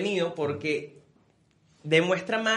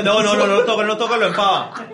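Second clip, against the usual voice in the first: mock warning; 3 semitones higher, 6 dB louder than usual. Venezuelan warning superstitiously.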